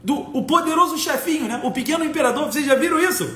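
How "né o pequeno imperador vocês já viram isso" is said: usual speaking voice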